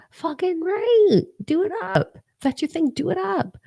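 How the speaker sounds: giddy high-pitched encouragement; high hyped cheer. Real, not acted.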